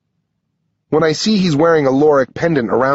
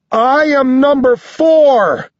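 high, loud, drawn-out declaration; full yell; 14 semitones higher, 5 dB louder, slower than usual. A narrator's reading. Narrator yelling his number to the boy, full voice. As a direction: shout it out slowly across a whole arena. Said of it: "I yell".

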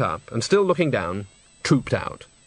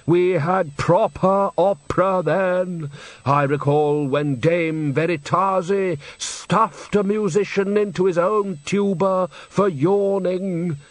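slow, lightly voiced old man relishing the past; nostalgic old director; 6 semitones higher, slower than usual. Old director recalling a diva's temper, slow and fond. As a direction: savour the memory like an old opera buff. Undershaft reminisces.

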